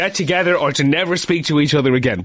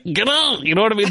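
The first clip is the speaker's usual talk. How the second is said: high-pitched exaggerated New Zealand accent; New Zealander pushed way up, chipmunk-like; mock New Zealand accent; 5 semitones higher than usual. Host mocking a New Zealander's pitch, high New Zealand accent. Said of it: "But then a New Zealander's like".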